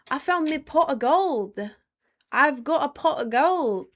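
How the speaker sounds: high, loud hammed-up Irish leprechaun; playing a leprechaun with a hammy Irish accent; mock Irish leprechaun. Answering what leprechauns say, in a hammed-up Irish accent. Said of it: "what do leprechauns say?".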